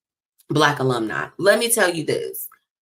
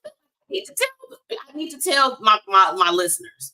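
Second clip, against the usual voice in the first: eager; 7 semitones higher than usual. Retold or imitated. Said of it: "I was like".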